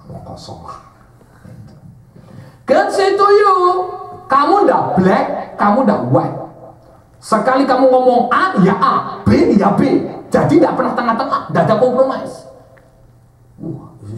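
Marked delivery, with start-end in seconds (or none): 0.1-0.8 low, soft brush-off; dismissive aside. Retold or imitated. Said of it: "saya bilang". 2.7-12.5 loud, high prophetic declaration; prophetess again. Retold or imitated. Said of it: "prophetess repeating her message".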